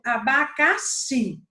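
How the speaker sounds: "abacaxi" with a long, forceful final "xi"; stress on last syllable. Shown as a demonstration. Teacher showing students the tonic syllable, "abacaxi" stressed on "xi". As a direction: draw out "abacaxi", leaning hard on "xi". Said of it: "eu falo com mais força".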